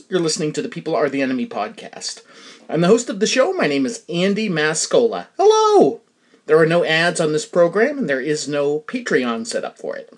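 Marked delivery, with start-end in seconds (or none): 5.4-6.0 bright hello. Real, not acted. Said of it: "host greeting".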